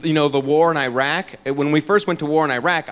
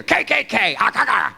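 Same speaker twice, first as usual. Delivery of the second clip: high, harsh barked syllables; imitated barking; 7 semitones higher than usual. Comic showing the harsh name, mock barking. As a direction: bark the syllables like a dog. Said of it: "It's like a dog barking".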